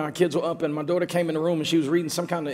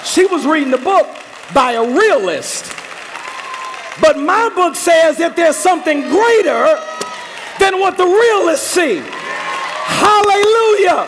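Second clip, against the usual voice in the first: rising to a shouted, higher peak, slow; full-force preaching; 12 semitones higher, 10 dB louder than usual. Acted. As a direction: preach it up to a roaring hallelujah.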